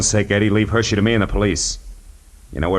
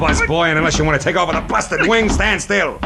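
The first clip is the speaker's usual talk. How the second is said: menacing; 12 semitones higher than usual. Acted. Detective pins him, threatens.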